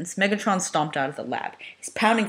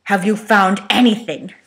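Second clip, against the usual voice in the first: growled demand; 4 semitones higher, 8 dB louder, slower than usual. Acted. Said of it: "Megatron growled".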